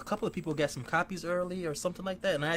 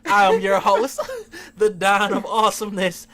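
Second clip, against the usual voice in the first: hammy host intro; 4 semitones higher, 9 dB louder than usual. Acted. Self-introduction as grand host.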